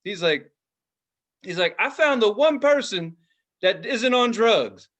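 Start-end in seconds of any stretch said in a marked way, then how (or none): 1.8-4.9 raised pitch, loud, slowing at the end; mock stage announcement. Comic tells festival crowd about the one sober person, loud mock announcement.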